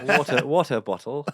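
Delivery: "water bottle" with the T's carefully put back in; effortful careful T. Shown as a demonstration. Comic practising his speech lesson, effortful and careful. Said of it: "that was hard".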